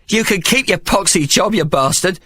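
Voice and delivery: high, furious woman's outburst; Mary's angry high-pitched cry. A narrator's reading. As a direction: lash out at him in a high voice. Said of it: "she cried".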